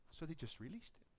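softly settling the point; quiet. Real, not acted. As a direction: drop it to near hush. Preacher drops voice.

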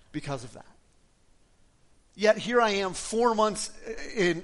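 normal talking voice

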